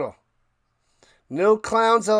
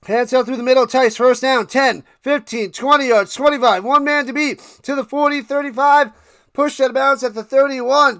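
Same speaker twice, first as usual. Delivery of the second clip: louder, higher rising call of the run; live play-by-play; 5 semitones higher, 6 dB louder than usual. Acted. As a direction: call the run like a TV announcer, building. Streamer calls the play live.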